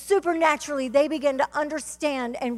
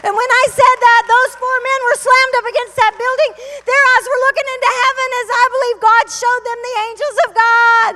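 high, loud, triumphant preaching; full-force preaching; 11 semitones higher, 12 dB louder than usual. Acted. Preacher tells congregation God saved her, full-force preaching. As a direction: proclaim the miracle at the top of your voice.

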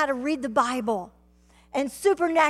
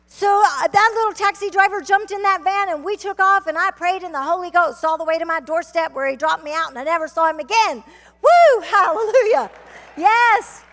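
raised, loud testimony with high whoops; exultant preaching; 6 semitones higher, 8 dB louder than usual. Acted. Preacher praises God for safe return home, exultant preaching. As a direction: wind up the rescue story in praise.

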